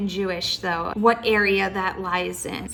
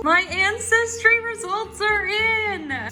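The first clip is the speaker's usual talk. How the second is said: big, much higher drumroll reveal; dramatic announcement; 10 semitones higher than usual. Acted. Vlogger hyping her viewers before the reveal, dramatic announcer voice. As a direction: announce it like a game show host. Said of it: "Cue a dramatic drum roll".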